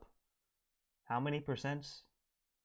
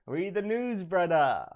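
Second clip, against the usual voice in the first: much higher, louder put-on bro voice; Chad impression; 7 semitones higher, 9 dB louder than usual. Quoting Chad to viewers, loud high bro voice. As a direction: bellow it like Chad hyping the chat. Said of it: "That's what he says".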